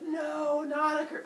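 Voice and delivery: loud, high mock dismay; hammed-up high-pitched protest; whining protest. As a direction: wail the objection high. Kronos protests the curse.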